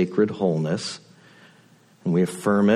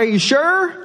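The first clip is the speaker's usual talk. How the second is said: very high, louder doubtful call; shouted question from a helicopter; 5 dB louder than usual. Retold or imitated. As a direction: yell it over rotor noise. Helicopter crew asks over noise.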